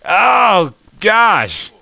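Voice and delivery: loud, high exclamation of exasperation; frustrated outburst. Real, not acted. Gamer failing the jump, loud frustrated outburst. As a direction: burst out in exasperation. Fails the jump yet again.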